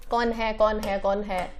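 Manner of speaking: low, hushed repeated question; musing to oneself. Real, not acted. Teacher scanning the options for the answer, quiet and low. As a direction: think aloud softly while searching for the answer.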